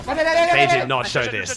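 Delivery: high, slow, drawn-out disbelief; shocked disbelief. Real, not acted. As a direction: stretch it out in shock. Shocked at missing broadcast.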